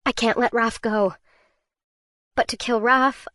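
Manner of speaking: ordinary delivery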